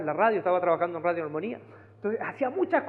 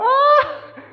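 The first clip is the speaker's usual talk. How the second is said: much higher, loud, laughing shriek; delighted squeal; 13 dB louder than usual. Retold or imitated. Preacher mimics sister Carol squealing with joy at good news. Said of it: "ya no era la hermana Carol contenta".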